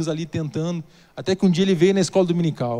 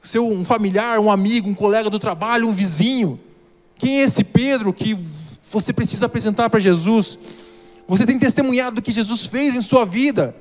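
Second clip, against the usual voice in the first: louder pulpit appeal, pressing the question; impassioned preaching; 3 semitones higher, 3 dB louder than usual. Acted. Pastor challenging the church to pray for a friend, fervent preaching.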